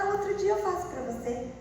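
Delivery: teasing mom. Retold or imitated. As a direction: brush it off sweetly. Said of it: "aí você fala". (mãe enrolando com voz aguda)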